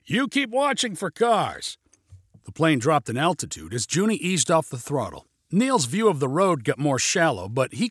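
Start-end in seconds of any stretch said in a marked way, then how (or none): 0.1-1.8 high, slow raised voice; yelled order. A narrator's reading. Pilot orders passenger to keep lookout, high yell. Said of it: "yelled Junie".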